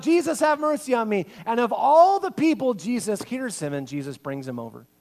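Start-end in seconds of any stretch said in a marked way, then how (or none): 0.0-1.3 high, loud plea; yelling out. Acted. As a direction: yell again for Jesus. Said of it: "yells out".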